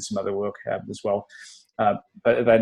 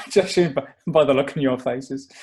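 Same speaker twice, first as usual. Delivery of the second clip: laughing; 4 semitones higher, 5 dB louder than usual. Real, not acted. Guest noticing his accent from their faces, laughing. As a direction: laugh through the words.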